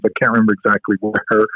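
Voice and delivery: ordinary talk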